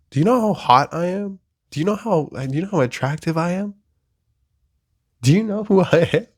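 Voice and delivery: high-pitched, hyped-up boast; mock bragging. Humoring himself about how attractive he is, mock boastful.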